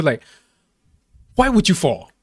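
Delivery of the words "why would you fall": blunt instinct. Retold or imitated. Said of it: "my first instinct was just to".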